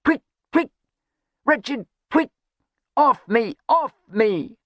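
high, frantic short cries; crying out. A narrator's reading. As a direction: yelp at unseen attackers. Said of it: "he cried".

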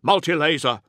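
high, loud combat report; sergeant reporting. A narrator's reading. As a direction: call the threat to your captain. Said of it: "reported one of the sergeants".

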